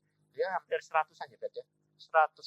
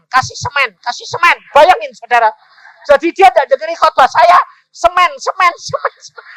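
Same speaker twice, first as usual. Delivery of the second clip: loud, high, animated sermon storytelling; full-force preaching; 13 semitones higher, 15 dB louder than usual. Retold or imitated. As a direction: act out the baffled listener at full volume. Cerita khotbah dengan suara penuh. (berkhotbah lantang dan berapi-api, nada tinggi)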